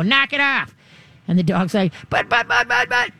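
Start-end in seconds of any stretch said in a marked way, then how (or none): 0.0-0.7 high sharp mock correction. Owner correcting a barking dog, sharp mock correction. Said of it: "give him a correction". 2.1-3.1 nervous dog. Retold or imitated. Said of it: "and he's nervous".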